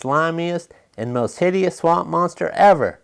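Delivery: usual speaking voice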